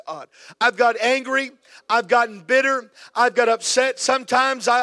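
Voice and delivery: loud, high pleading prayer; impassioned loud prayer. Acted. Pastor leading the church in repentance, loud and high. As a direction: cry out to God for the whole room.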